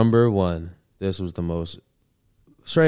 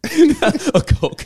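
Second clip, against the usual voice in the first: laughing; 4 dB louder than usual. Real, not acted. Laughs at the Coke joke.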